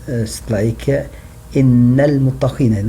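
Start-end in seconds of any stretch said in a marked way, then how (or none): 1.5-2.9 إن المتقين with the noon sounded, quick; Quran recitation. Shown as a demonstration. Teacher quotes the Quran to show the sounded N. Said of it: "like that in Arabic".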